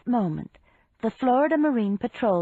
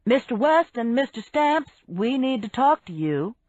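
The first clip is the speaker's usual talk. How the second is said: raised official summons; calling out; 4 semitones higher, 3 dB louder than usual. A narrator's reading. Major summoning two men off the boat, calling out. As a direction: call both men over firmly. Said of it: "Major Bissell called".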